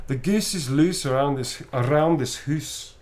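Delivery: slow Scottish accent demo, hoose for house; a Scottish accent, slowly shown; a light Scottish accent, taught slowly. Shown as a demonstration. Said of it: "Try it".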